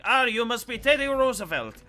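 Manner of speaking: put-on jokey French accent for the diplomat; mock French accent. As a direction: put on a cartoon French nobleman greeting. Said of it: "this French motherfucker shows up".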